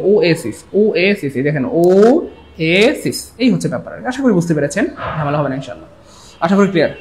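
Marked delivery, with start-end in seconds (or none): 0.1-3.2 ওয়েসিস as "oh-ay-sis", slowing to stretched syllables; pronunciation demonstration. Shown as a demonstration. Teacher recapping for students, stretched-out pronunciation. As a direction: draw out ওয়েসিস sound by sound, slower at the end. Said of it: "ওটার উচ্চারণটা এই যে".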